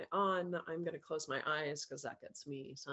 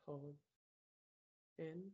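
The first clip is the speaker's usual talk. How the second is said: calm meditation voice, softer than chat, with long pauses; guided meditation; 4 dB quieter than usual. Acted. Coach guiding a group through breaths and self-love, calm meditation voice.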